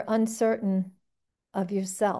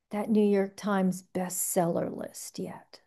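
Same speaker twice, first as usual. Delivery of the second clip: a hushed, confiding whisper; whispered aside; 3 semitones lower, 7 dB quieter than usual. Real, not acted. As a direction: trail into a whisper. Rueful aside on unmet goal.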